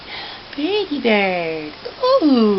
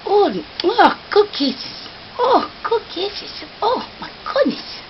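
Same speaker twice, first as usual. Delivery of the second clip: excited cooing; 10 semitones higher than usual. Real, not acted. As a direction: gush over the bird, pitch way up.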